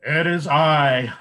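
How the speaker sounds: announcing big goat. A narrator's reading. Biggest goat announces itself to the troll, slow and proud. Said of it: "the biggest billy goat announced".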